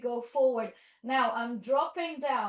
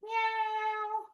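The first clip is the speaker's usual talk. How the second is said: much higher, drawn-out meow; playful kitty cry for the class; high cat meow; 10 semitones higher than usual. Shown as a demonstration. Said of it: "It's a happy cat".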